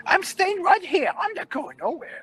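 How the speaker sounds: high, squealing defiance; arrogant old woman. Retold or imitated. Woman refusing to walk out, arrogant high squeal. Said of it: "Very arrogant".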